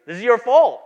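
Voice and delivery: hammed-up accusation, loud and sharp; mock accusing shout. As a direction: pretend angry God pointing the finger. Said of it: "God doesn't say".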